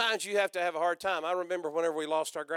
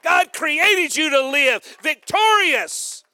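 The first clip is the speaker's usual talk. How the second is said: loud, slow, shouted sermon climax; full-force preaching; 10 semitones higher, 12 dB louder than usual. Acted. Preacher shouting the climax to the congregation, loud.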